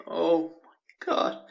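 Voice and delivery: very slow, drawn-out relieved breath; huge exhaled oh my God; sigh of relief. Retold or imitated. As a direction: let all the tension out. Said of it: "the sigh of relief".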